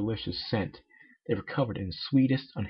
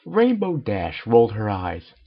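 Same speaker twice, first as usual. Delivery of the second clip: epic narration; 7 dB louder, slower than usual. A narrator's reading. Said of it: "I read in epic voices".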